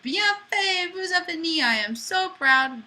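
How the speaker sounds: high, loud, delighted praise; excited praise. Real, not acted. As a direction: cheer them on proudly. Praising students at the end.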